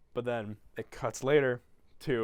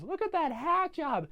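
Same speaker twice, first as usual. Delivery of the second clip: put-on high, slow sneering kid voice; mocking bully; slower than usual. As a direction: jeer slowly and high at him. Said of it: "Augustus goes".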